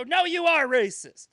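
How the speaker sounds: high, loud, insistent retort; mock accusation. Accusers refusing to be reasoned with, loud mock accusation. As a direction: shout them down in an imitated quarrel.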